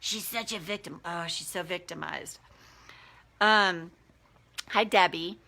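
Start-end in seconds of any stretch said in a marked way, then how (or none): 0.0-2.4 inner bully voice. Shown as a demonstration. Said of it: "That's the bully in your brain telling you to say that".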